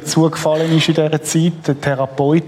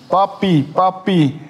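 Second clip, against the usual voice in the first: put-on higher calling voice of children; 4 semitones higher, slower than usual. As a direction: call out like kids in the yard. Children calling from prison yard.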